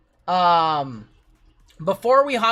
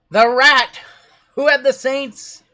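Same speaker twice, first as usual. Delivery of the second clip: excited announcing; 6 semitones higher, 6 dB louder, slower than usual. Real, not acted. Announcing the race winner.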